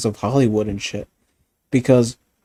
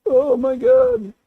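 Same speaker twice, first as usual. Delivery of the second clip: high whiny mock voice, much higher; mocking whiny critic; 11 semitones higher than usual. Mocking critics who cancel shows, a high whiny voice. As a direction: sneer it like a whining critic.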